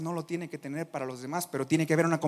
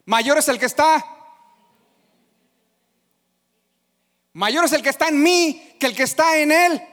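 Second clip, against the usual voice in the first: loud, raised declaration; proclaiming scripture; 8 semitones higher, 10 dB louder than usual. Acted. Preacher proving he knows the verse. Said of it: "yo sí me la sé".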